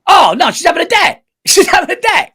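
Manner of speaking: high, loud mocking outburst with a laugh; hammed-up shout of disbelief. Real, not acted. Friend mocking wife's excuse, loud high shout. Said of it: "mocking wife's phrase, shouting".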